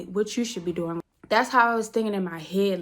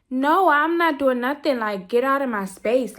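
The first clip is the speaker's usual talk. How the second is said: defiant refusal; 6 semitones higher, 6 dB louder than usual. Retold or imitated. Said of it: "that's how I was there feeling".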